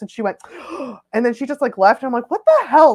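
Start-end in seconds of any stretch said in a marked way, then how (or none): 0.4-1.1 short theatrical gasp; mock shocked gasp. Imitating the woman's shocked reaction. 2.3-2.9 high, loud mock outrage; indignant exclamation. Retelling her indignation at the rebuff.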